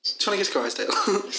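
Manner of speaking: high, fast mock voice; mocking tutor. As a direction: toss off the tutor's line as a joke. Teasing the tutor's catchphrase.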